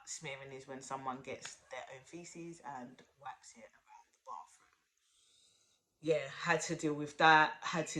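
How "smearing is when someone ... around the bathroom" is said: hushed whisper of an embarrassing detail. Real, not acted. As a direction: whisper it so nobody hears. Explaining crude detail discreetly.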